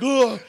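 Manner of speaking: high loud monster grunt-laugh; Frankenstein laugh. Retold or imitated. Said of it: "Frankenstein laugh".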